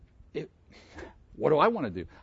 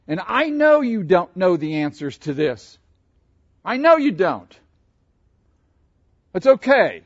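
louder, higher, fervent comfort; God comforting; 5 semitones higher, 9 dB louder than usual. Retold or imitated. Preacher voicing God comforting him, raised and fervent. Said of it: "minister to me in that place and say".

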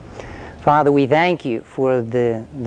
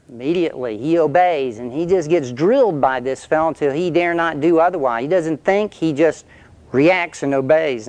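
ordinary talk

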